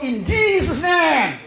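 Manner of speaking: slow, drawn-out declaration of the name; slow preacher's invocation; prayer proclamation. Shown as a demonstration. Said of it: "pakai Yesus kok".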